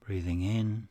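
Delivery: unhurried, soothing delivery, letting each phrase settle; guided meditation. Acted. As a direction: guide them gently into stillness, phrase by phrase.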